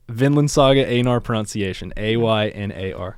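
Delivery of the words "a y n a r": ordinary talk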